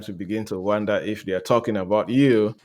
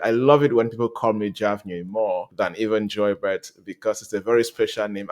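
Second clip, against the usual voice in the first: ordinary talk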